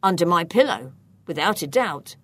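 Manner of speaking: low, earnest reply; serious. A narrator's reading. Gaston answering where he would hide something, serious. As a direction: answer gravely, pitched low. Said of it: "In a serious tone".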